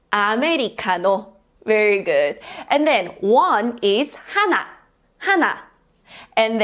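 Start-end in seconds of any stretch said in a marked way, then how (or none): 0.1-1.3 Americano in Korean syllables, "a-me-ri-ka-no"; Korean pronunciation. Shown as a demonstration. Teacher leads learners in practice, Korean-pronounced Americano. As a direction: say Americano with Korean vowels and a tapped r. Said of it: "let's practice one time together in Korean pronunciation".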